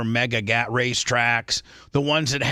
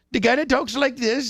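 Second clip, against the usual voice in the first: mock Indian accent, pitched higher; quick demo of an Indian man's accent; 10 semitones higher than usual. Shown as a demonstration. Teller sketching the big Indian guy in a higher mock Indian accent. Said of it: "this guy from India".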